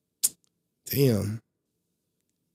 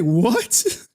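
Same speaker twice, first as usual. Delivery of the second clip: retold shock; 8 semitones higher, 4 dB louder than usual. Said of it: "I was like".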